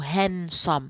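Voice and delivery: handsome said as "hansom", no d sound; silent d in handsome. Shown as a demonstration. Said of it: "في كلمة handsome ما ننطقوش الدي". (handsome بدون نطق الدال: هانسوم)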